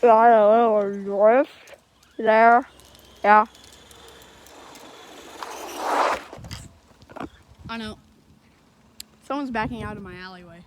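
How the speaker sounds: talking around a phone in mouth; phone held in teeth. Real, not acted. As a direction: speak with a phone between the teeth. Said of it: "put the pop socket on my phone in my mouth".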